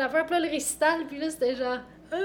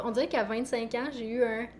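normal talking voice

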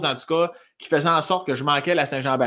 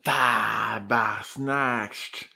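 very slow, low, softer swear; drawn-out swear; 4 semitones lower, 5 dB quieter, much slower than usual. Acted. Pondering the most hated word, drawn-out low curse. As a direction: stretch every syllable out long. Long mock swear while thinking.

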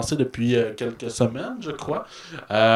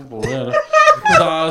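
emphatic; 7 dB louder, much slower than usual. Real, not acted. Emphatic not caring.

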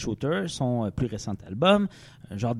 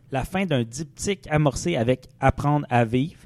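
usual speaking voice

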